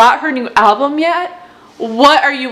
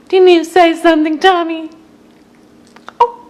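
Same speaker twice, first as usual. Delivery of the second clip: high, quick sing-song coo; 6 semitones higher than usual. Real, not acted. Talking to her pet Tommy in high, quick baby talk.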